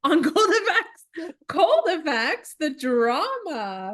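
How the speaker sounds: high, slow, laughing through words; drawn out, amused and incredulous; laughing disbelief. Real, not acted.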